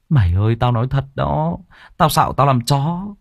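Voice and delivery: high-pitched, earnest swearing; acted plea, voice lifted high; pleading insistence. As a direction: plead to be believed. Friend swears he is telling truth.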